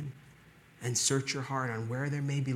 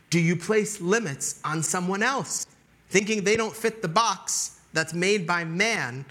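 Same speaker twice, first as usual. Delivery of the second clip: loud, raised, probing challenge; forceful preaching; 5 semitones higher, 5 dB louder than usual. Real, not acted. Preacher challenging listeners on limits they place, forceful preaching. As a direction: press the questions on the congregation.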